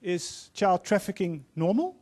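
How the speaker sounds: ordinary delivery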